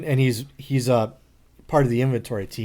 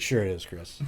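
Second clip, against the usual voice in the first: mock dismissive; 6 semitones lower, 9 dB quieter than usual. Dismissive reply to coworker.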